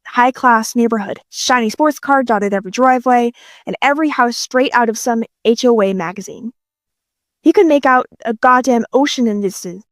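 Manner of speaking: ordinary delivery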